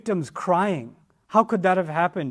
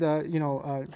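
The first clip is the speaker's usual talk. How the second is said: ordinary talk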